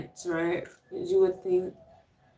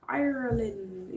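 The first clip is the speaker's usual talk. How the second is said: slow, soft Irish-accented "Ireland"; 2 semitones higher, 7 dB quieter, slower than usual. Shown as a demonstration. Greeting a viewer from Ireland, soft slow Irish accent. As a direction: draw out "Ireland" in a light Irish lilt. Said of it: "You can't just say Ireland regular".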